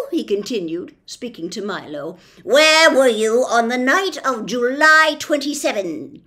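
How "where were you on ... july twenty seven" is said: loud, slowish officious policeman; pompous policeman. Acted. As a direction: lay down the law with bluster. Policeman interrogates.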